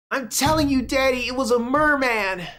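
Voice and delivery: put-on high child voice, pleading; a kid who won't be doubted; insistent little girl. Clara insists to her daddy she saw a merman, high insistent child.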